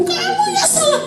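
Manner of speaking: very high-pitched pouncing blame; hammed-up gloating accusation; mock accusing. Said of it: "Tapi saya bukan yang mumpung".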